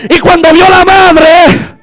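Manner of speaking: strained, shouted pulpit storytelling; impassioned preacher building to the miracle; full-force preaching. Real, not acted. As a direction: cry out each phrase to the congregation.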